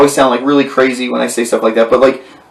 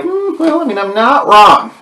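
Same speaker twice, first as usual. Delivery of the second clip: smug taunt; 10 semitones higher than usual. Retold or imitated. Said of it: "I'm like".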